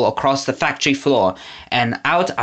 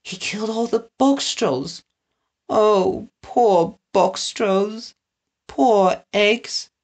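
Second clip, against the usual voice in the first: tearful mourning; 7 semitones higher, slower than usual. A narrator's reading. Winnie grieves the box trolls, near tears. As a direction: mourn each name. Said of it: "fighting back tears".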